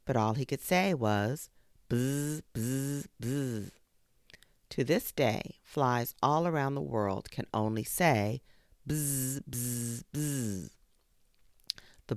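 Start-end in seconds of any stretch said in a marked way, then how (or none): 1.9-3.7 soft, quiet buzzing; quiet insect buzz; fly buzzing. Acted. Fly can only buzz. 8.9-10.7 soft, quiet buzzing; quiet insect buzz; fly buzzing. Acted. Flies can only buzz.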